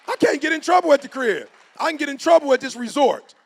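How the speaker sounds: high, loud, fast retelling of an argument with the host; hammed-up insistence on going home; animated retelling.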